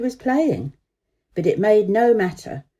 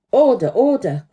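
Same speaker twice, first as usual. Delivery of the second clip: loud parliamentary call for order; a cracker shouting order in the house; cried out; 4 semitones higher, 7 dB louder than usual. Acted. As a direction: call the room to order like a politician. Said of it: "cried out a cracker".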